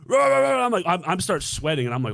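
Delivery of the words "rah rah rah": loud barking imitation, high; mock Doberman barks; dogs barking. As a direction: bark like angry guard dogs. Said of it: "they were just like".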